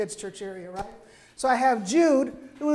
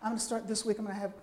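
quiet resolve; 2 semitones lower, 11 dB quieter, much faster than usual. Acted. Voicing a believer's quiet resolve.

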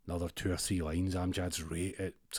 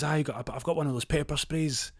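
high angry threat; 6 semitones higher, faster than usual. Retold or imitated. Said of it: "He goes".

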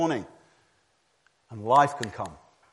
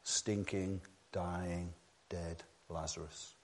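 low, soft and grave; hushed; 5 semitones lower, 12 dB quieter, slower than usual. Real, not acted. Preacher tells hearers they are dead Lazarus, hushed. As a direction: drop the voice right down.

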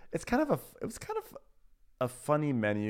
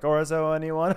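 higher, louder hostess offer breaking into mock laughter; imitated nervous laughing; 5 semitones higher, 6 dB louder than usual. Hostess overserving orzo to guests, mock nervous laughing. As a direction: imitate a hostess who can't stop laughing. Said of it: "she cannot stop laughing".

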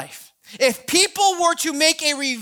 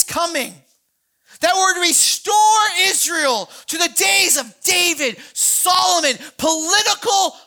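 raised, ringing sermon voice; fervent preaching; 5 semitones higher than usual. Real, not acted. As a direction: preach it out with rising fire. Preaching builds to full force.

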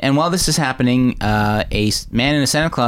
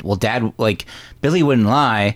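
usual speaking voice

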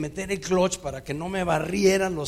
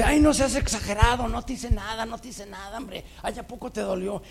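higher and louder, brushing it off; mock him for exaggerating; dismissive scoff; 7 semitones higher, 5 dB louder than usual. The girlfriend scoffs at his pain, higher and loud.

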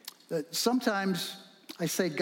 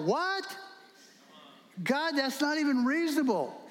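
shocked squeak up high, then protest; a pitched-up "What?" of disbelief; incredulous; 9 semitones higher than usual. Retold or imitated. Joshua protesting to God, high incredulous disbelief.

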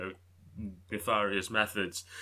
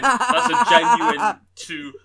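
much louder, higher, laughing through the words; 11 semitones higher, 11 dB louder, much slower than usual. Real, not acted. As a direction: blurt it out loudly while cracking up. Cracking up at own joke.

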